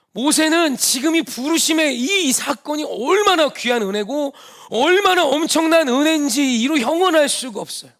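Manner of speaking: fervent sermon climax; full-force preaching. Acted. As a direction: preach it with fire, rising to a climax.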